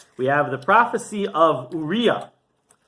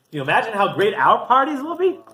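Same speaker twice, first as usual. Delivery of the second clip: higher, rising rhetorical question; acted delight, pitched up; excited anticipation; 6 semitones higher, 4 dB louder than usual. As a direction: marvel aloud at what is to come. Akiva's joyful answer, acted.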